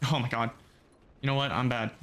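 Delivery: ordinary delivery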